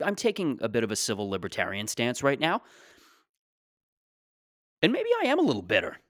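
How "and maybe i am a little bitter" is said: high squeaky aside. Acted. Comic self-pitying aside.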